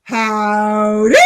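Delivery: hammed-up country hello; mock Tammy greeting. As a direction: sing out a folksy cowboy hello. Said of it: "Tammy comes along and says".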